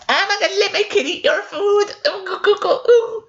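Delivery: giggling high mock voice; mocking entitled parent. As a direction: lampoon the parent in a silly shrill falsetto.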